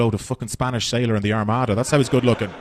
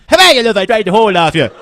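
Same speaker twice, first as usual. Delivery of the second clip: high, loud, rough Monaghan woman; rough Monaghan accent; 9 semitones higher, 7 dB louder than usual. Retold or imitated. Woman in Monaghan chatting up, rough Monaghan accent. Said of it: "Go to places like Cavan and Monaghan".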